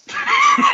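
high, loud laugh bursting out; gleeful high laugh; burst of laughter. Real, not acted. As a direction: crack up at the payoff. Laughing at the story's payoff.

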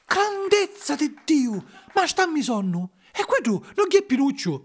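high-pitched puppet voice, amazed; astonished puppet cry. A narrator's reading. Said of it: "sacca a gridare".

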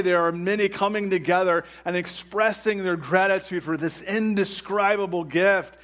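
high, loud, impassioned preaching. Real, not acted. Preaches at full force.

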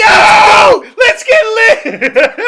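high laughing shout; rowdy party animal. Pretend. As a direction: whoop it up, wild party.

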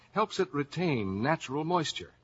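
ordinary talk

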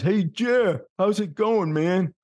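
put-on high-pitched hello to an old pal; one of the guys calling hello; hearty friendly greeting. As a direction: greet a buddy from the old neighborhood.

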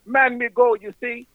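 high-pitched comic Jamaican patois; mock Jamaican accent. Shown as a demonstration. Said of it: "If you really want to speak Jamaican".

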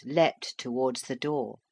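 usual speaking voice

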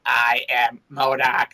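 slow mechanical villain voice; MODOK voice. Retold or imitated. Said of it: "the mechanical voice".

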